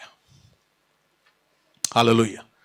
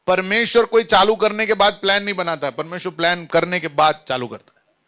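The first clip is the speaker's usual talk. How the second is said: ordinary talk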